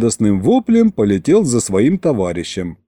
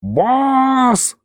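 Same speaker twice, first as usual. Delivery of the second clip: much higher, drawn-out imp's wail; 12 semitones higher than usual. Acted. Aristofan wailing into the narrator's ear. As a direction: wail to the boss. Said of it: "снова завопил он".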